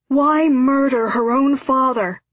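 high, loud pleading question; desperate appeal. A narrator's reading. Father demands why X would murder him, desperate appeal. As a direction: cry out in urgent protest.

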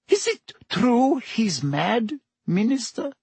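a high woman's voice dropping to a hush; hushed barmaid. Acted. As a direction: ask it high and hushed, half afraid. Rosmerta asks fearfully.

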